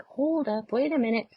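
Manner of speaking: high, startled hold up; sudden alarm. Retold or imitated. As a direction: catch yourself as the numbers drop. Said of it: "I'm like".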